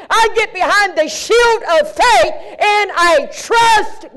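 rising to a shouted climax; full-force preaching. Acted. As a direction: drive each phrase home to the congregation. Preaching build to shield of faith.

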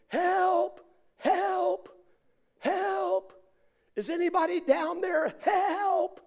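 very high, wailing call; hammed-up desperate yell into the distance; cry for help. Man hanging on a cliff, high desperate cry.

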